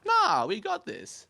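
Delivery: high, slow, breezy Lady Macbeth; mock Lady Macbeth. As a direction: squeak it out high and slow, dismissive. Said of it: "She's like".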